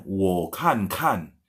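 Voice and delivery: "wo kan kan" said very slowly, each "kan" a falling tone; careful teacher demo of "wo kan kan", "kan" falling; proper Mandarin tones. Shown as a demonstration. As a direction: model "wo kan kan" slow and carefully toned. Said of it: "Padahal aslinya".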